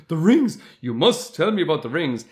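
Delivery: high, loud burst of urgency; eager demand. Acted. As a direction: act out a character pouncing on the news. Acts Celebrimbor's immediate eager demand.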